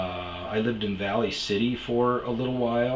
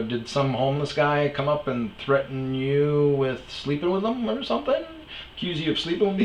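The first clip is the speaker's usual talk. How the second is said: higher mocking question; teasing guess; 6 semitones higher than usual. To the bouncer, joking guess with a raised teasing voice. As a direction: needle the bouncer with it. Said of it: "I go".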